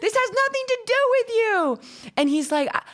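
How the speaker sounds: high, exasperated mock protest; mock exasperation. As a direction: pitch way up in comic disbelief. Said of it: "I'm like".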